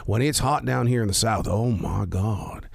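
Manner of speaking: stretched, low-ending Southern drawl. Shown as a demonstration. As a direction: slip into a Southern drawl, slowing at the end. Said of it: "slip into that Southern drawl".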